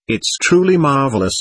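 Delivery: plain reading voice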